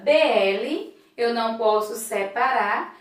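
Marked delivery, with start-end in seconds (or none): none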